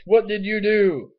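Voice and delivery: high, loud, drawn-out mock outrage; all-caps shout. Reading a friend's alarmed text aloud, all-caps shout. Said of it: "text message in all caps".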